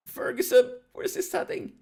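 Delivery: real high, cracking commentary cry; voice breaking. Real, not acted. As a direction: let the voice crack as it climbs with excitement. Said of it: "oh my god, my voice is breaking".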